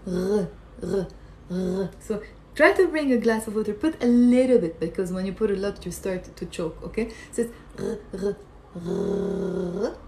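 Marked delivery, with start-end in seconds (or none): 0.1-1.9 separated "r" rasps, as if gargling water; gargled throat sound. Shown as a demonstration. Teacher showing where ghain is made, gargled "r". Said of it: "activating the part of your throat". 7.8-10.0 "r" gargled in the throat, the last one drawn out; gargled throat sound. Shown as a demonstration. Teacher demonstrating gargling for learners, throaty drawn-out "r". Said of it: "That sound".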